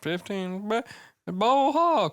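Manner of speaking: squeaky high mock protest. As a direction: pitch it way up in mock defense. Mocking reply, high squeaky.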